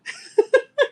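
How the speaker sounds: high, loud burst of laughter; real laugh. Real, not acted. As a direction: crack up at your own story. Laughs at her own story.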